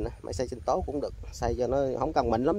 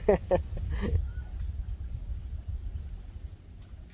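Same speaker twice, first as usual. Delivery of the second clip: breathy laugh; 7 semitones higher, 5 dB quieter than usual. Real, not acted. Laughing after fish escapes.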